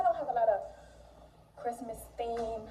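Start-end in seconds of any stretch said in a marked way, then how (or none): none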